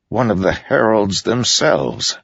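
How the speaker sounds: usual speaking voice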